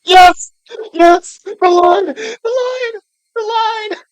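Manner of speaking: very loud, high screams of excitement; ecstatic screaming. Real, not acted. Player reaching the awaited line, ecstatic screaming. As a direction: scream with fan excitement, far above talking pitch.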